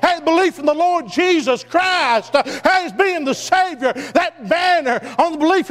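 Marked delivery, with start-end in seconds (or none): none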